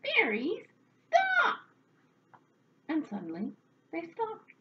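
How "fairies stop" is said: loud, high shout building to a shriek; yelled command. A narrator's reading. Old woman silencing the rackety fairies, high sharp yell. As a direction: yell to halt a racket at once. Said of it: "she yelled".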